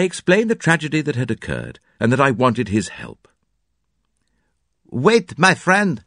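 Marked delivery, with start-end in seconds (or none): 4.9-6.0 put-on high, lightly accented Belgian detective; Poirot voice. Poirot calling down from the window to his friend, high and lively. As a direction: call down kindly from a window.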